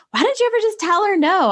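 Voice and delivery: high, quick imitation of a teen; complaining twin sister. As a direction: imitate a sibling's exasperated protest.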